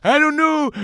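put-on high, strained old-guy outburst; baffled old man demanding an explanation; befuddled old guy. Guy retelling his confusion over slang, old-guy voice. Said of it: "like an old guy".

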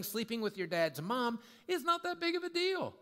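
high-pitched mock dismissal; dismissive church. Church excusing scandal to the culture, dismissive.

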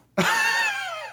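a quick high burst of laughter; a real laugh at a dumb criminal. Real, not acted. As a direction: laugh up high at the gang-leader joke. Laughs after Dunzo joke.

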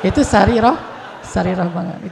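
laughing through the words. Real, not acted. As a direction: chuckle it out, amused. Preacher laughs at own joke. (sambil tertawa)

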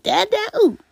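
put-on very high squeaky baby ooh; imitating a toddler's high dad ooh; baby's silly sound. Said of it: "a silly sound at the end".

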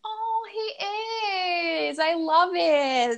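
very high, slow delighted squeal. Real, not acted. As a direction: gush about it. Delighted about cat guest.

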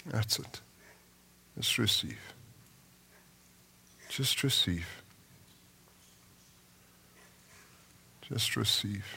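very soft, hushed and unhurried; soft prayerful murmur. Real, not acted. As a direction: murmur it gently as the congregation receives prayer. Prayer ministry moment, soft invitation.

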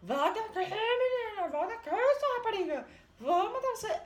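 high, loud furious roar; villain shouting. Retold or imitated. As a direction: chase her down yelling threats. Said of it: "o Barba Azul gritando". (Barba Azul gritando ameaças)

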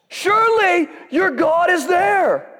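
high, slow, loud mock taunt. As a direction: jeer at the prophets. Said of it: "starts taunting them".

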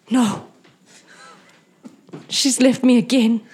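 hammed-up despair; heartbroken lover. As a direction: wail your loss melodramatically. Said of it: "and he goes".

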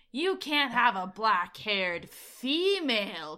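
slow, loud mock disbelief; incredulous Busey. Podcaster imitating Busey objecting to the contact, incredulous. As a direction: stretch it out, scoffing at the very idea. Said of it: "he is so, like".

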